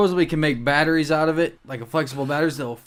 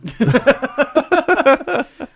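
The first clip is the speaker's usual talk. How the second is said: loud burst of laughter; real laughing fit; 10 semitones higher, 7 dB louder than usual. Real, not acted. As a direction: break up laughing hard. Laughs at piss-beer marketing.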